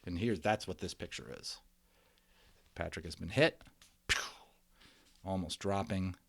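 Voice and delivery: usual speaking voice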